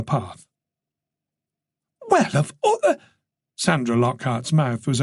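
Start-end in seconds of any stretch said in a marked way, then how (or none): 2.1-3.0 high, outraged exclamation; indignant gasp. A narrator's reading. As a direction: splutter in shock. Lockhart outraged at the grab.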